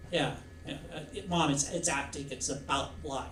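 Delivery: put-on soft, hesitant child's reply; meek child protesting. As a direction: mumble a small defence to Mom. Child answers scolding mother.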